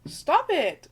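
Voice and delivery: ordinary delivery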